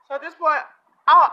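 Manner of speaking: usual speaking voice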